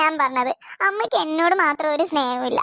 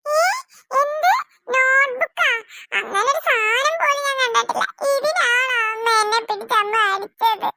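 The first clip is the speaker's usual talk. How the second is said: put-on higher, outraged small girl; indignant little girl; 6 semitones higher, slower than usual. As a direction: protest you never saw it. Little girl protests innocence.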